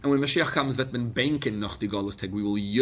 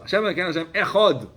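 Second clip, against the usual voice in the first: loud, fast chanted Shema, pitched higher; fervent loud proclamation of the Shema; fervent proclaiming; 8 semitones higher, 8 dB louder, faster than usual. Shown as a demonstration. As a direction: cry out the Shema with fervour. Calling out the Shema.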